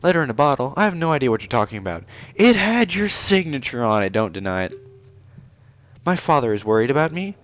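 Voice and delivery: put-on high-pitched haughty young princess; haughty princess Ruto. Princess Ruto introducing herself to the stranger, high and proud.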